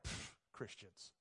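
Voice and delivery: whispered, slow scoff. Retold or imitated. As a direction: dismiss them with contempt. Scornful look from dorm mate.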